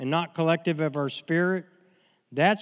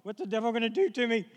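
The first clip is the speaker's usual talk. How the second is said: lightly voiced mock fright, a little higher; mock scared; 5 semitones higher, 4 dB quieter, faster than usual. Preacher mocks a frightened believer fretting about the devil, in mild mock fear. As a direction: act out a jittery churchgoer, softly. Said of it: "Don't walk around like this going".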